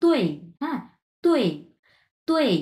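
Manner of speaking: "তুই" starting high and falling sharply; fourth tone. Shown as a demonstration. Teacher demonstrates tone four, a falling syllable. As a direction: drop the pitch through each "তুই". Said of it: "চার number টোনের মতোই".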